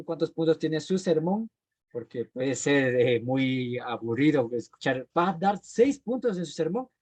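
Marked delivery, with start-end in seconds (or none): 5.2-6.9 high-pitched dismayed question; incredulous listener. Acted. Churchgoer hearing how many points the sermon has, incredulous. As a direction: ask it high, in mock disbelief. Oyente ante un sermón aburrido.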